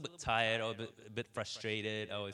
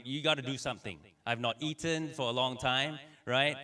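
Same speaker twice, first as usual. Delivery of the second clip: much louder, higher angry complaint; raised voice; 4 semitones higher, 6 dB louder than usual. Retold or imitated. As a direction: let the anger finally come out. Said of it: "I was feeling it coming out".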